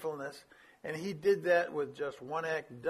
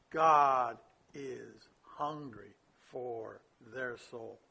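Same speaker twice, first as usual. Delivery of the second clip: very slow, weighty delivery, word by word; grave emphasis; 7 semitones lower, much slower than usual. Real, not acted. Preacher stressing a critical point.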